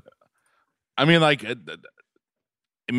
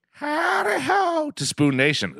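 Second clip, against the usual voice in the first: show host greeting; slower than usual. Acted. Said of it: "Rough performance by me there".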